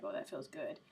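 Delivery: low, soft blissed-out cat voice; contented cat. Pretend. Voicing the cat having its belly rubbed, playfully contented. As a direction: murmur it low, as a relaxed pet.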